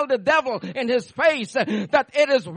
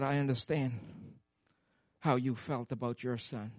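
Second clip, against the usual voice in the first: tender God; 8 semitones lower, 10 dB quieter than usual. Retold or imitated.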